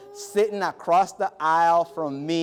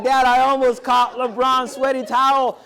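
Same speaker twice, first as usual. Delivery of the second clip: higher and louder, breathless with news; excited call to dad; 7 semitones higher, 7 dB louder than usual. Retold or imitated. Calling his dad about the towel, excitedly. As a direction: blurt it out to Dad.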